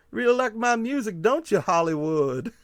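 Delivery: hammed-up folksy mockery; gentleman bumpkin voice. Teasing a concert fan in the gentleman bumpkin voice. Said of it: "start talking like this".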